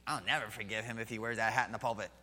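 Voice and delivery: quick, mocking voice of a petty churchgoer; mock the offended churchgoer's grudge.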